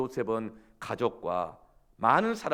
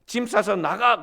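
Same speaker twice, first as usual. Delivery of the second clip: much higher, loud angry yelling; husband yelling; 9 semitones higher, 8 dB louder than usual. Retold or imitated. Gyeongsang husband yelling at his wife in a fight. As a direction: shout your frustration at your wife. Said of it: "이럴 줄 알았는데".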